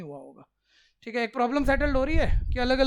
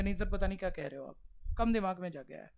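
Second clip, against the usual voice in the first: confused student; 5 semitones lower, 8 dB quieter than usual. Retold or imitated. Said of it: "कुछ अभी confuse होंगे".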